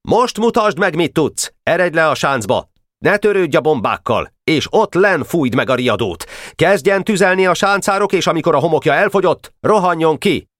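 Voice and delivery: high, urgent battlefield command; shouted orders. A narrator's reading. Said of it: "kiáltotta Boka". (magas hangon kiáltott, sürgető parancs)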